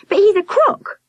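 put-on high, indignant child's complaint; complaining girl. A narrator's reading. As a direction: whine it, sure you're right. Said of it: "Victoria complained".

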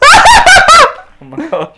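very high, slow, laughing shriek; hysterical laughter. Real, not acted. Players watching an absurd defeat, high hysterical laughing.

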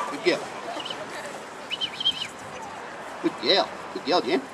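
low, soft praise to a pup; soft, low praise for a good pup. Real, not acted.

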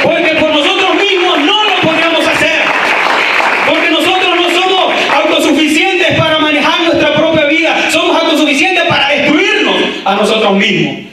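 high-pitched impassioned sermon shout; full-force preaching. Real, not acted. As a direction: preach it out with high, ringing urgency.

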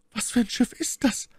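very high falsetto lady's question; inquiring My Lady. A narrator's reading. Lady questioning her rescuer, high-pitched curiosity. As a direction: ask in a high, put-on lady voice.

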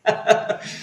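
laughing at himself. Real, not acted. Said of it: "Me río de mí mismo".

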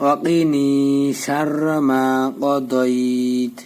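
slow teaching recitation, long "ni" and "maa"; joined recitation. Shown as a demonstration. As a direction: draw out "dai" in কদইত and stop on t. Joins the spelled words in recitation. (কদইত-এ লম্বা টান দিয়ে তিলাওয়াত)

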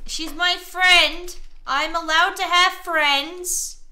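defensive. Real, not acted. Player defending a friendship to a jealous partner, defensive. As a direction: spell it out loudly, indignant. Defending herself in the game quarrel.

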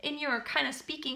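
usual speaking voice

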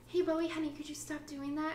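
a mock honeyed mom's request; sweet mom voice. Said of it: "You can't just be like".

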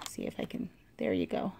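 whispered to herself while fiddling; barely voiced, under her breath; whispered aside. Real, not acted. Crafter adjusting the box, whispering to herself.

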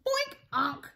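high "boink", then a honk; sudden cartoon bonk and goose noise. Runners bump into the goose, high bonk and honk.

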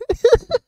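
a sudden, high giggle; a quick squeaky laugh at his friend; high burst of laughter. Real, not acted. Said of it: "hör mal auf zu lachen".

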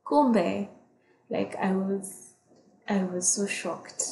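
ordinary talk

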